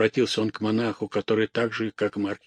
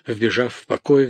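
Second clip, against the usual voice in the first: usual speaking voice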